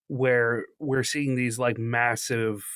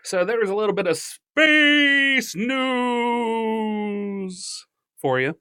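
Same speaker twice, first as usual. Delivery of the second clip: big dramatic slow announcer; 9 semitones higher, 5 dB louder, slower than usual. Acted. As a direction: boom it out like a trailer voice. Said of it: "you didn't use the echo".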